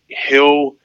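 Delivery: normal talking voice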